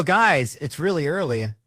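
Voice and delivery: put-on loud, high, fed-up shout at the kids; exasperated yell. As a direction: yell down the stairs at giggling kids. Dad yelling at kids early morning.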